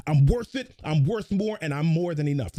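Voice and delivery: pumped-up affirmation; energized. Shown as a demonstration. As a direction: put your whole body into it. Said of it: "get your physiology into it".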